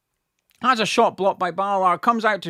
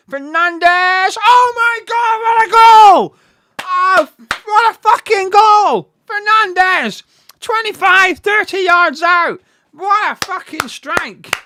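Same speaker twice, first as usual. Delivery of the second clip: screaming in disbelief, much higher and louder; goal celebration; 11 semitones higher, 11 dB louder, slower than usual. Real, not acted. West Ham fan roaring at a long-range goal, ecstatic scream. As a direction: roar it like your team just scored a screamer.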